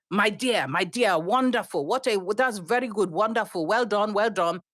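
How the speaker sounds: put-on gushing older woman in a thick Nigerian accent. Older Nigerian passenger praising the pilot, in a thick Nigerian accent. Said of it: "in this lovely, thick Nigerian accent".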